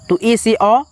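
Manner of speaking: ordinary delivery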